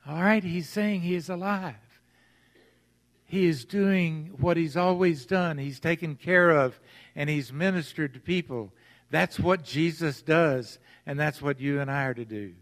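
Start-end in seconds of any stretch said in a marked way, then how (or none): none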